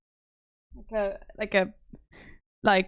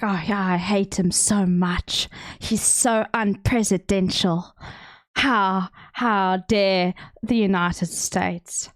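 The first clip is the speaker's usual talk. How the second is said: slow, drawn-out mock Afrikaans accent; exaggerated Afrikaans accent; slower than usual. Streamer imitating parents watching Trump on TV, exaggerated Afrikaans accent. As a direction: put on a thick Cape Town wine mum Afrikaans accent. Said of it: "they don't sound that Afrikaans".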